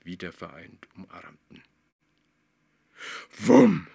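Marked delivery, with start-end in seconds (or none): 3.4-3.9 high loud exclamation; loud bang. A narrator's reading. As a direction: burst out with the crash. Plötzlicher Zusammenstoß. (lauter Knall)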